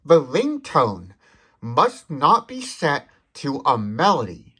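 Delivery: ordinary delivery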